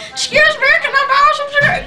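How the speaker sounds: very high, quick put-on voice; imitating friend's voice. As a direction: mimic your friend in a squeaky request. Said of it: "That's really how she talks".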